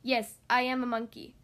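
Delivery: plain reading voice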